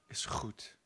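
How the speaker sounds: breathy hushed whisper; God revealing his nature in a whisper. Acted. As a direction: whisper it like a secret. God's essence revealed.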